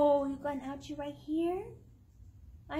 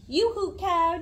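louder sing-song yoo-hoo; calling out; 4 semitones higher, 6 dB louder than usual. Acted. Mother reading, calling out to the cow. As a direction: call across the field.

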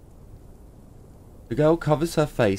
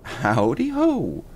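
drawling greeting; 6 semitones higher, slower than usual. Acted. Stranger greets in put-on voice.

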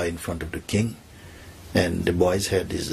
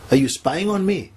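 high-pitched, indignant questioning; accusing king; 9 semitones higher than usual. Acted. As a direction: confront him with startled suspicion, pitch raised. King accuses the temple boy.

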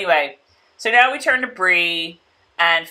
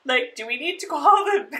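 mock surprise; 14 semitones higher, 3 dB quieter, slower than usual. Reacting to pregnant belly.

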